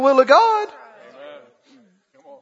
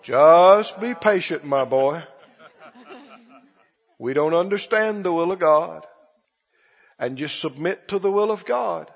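preacher imitation; 6 semitones lower, 4 dB quieter than usual. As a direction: stretch it out like a resigned, pious preacher. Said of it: "talk like this and pray like this".

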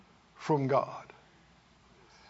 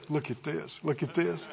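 much softer and lower, intimate reveal; hushed God voice; 7 semitones lower, 9 dB quieter than usual. Acted. God unveiling more goodness to believers, softly hushed. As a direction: lean in and murmur like showing a secret treasure. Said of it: "He'll say".

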